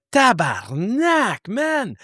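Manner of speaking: high, slow French Canadian swear; French Canadian curse. Retold or imitated. Cop swearing at the craft in French Canadian, high and slow. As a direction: blurt it out in shocked French Canadian. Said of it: "in French Canadian".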